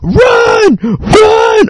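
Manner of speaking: very high acted-out yell; frantic retold shout to run; shouted warning. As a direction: scream it as if fleeing. Acts out fleeing from the van.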